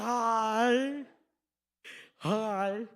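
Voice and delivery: drawn-out mimicked greeting through braces; mocking copy of headgear speech. Joker looking right at the worker, mocking headgear voice. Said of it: "do the exact same".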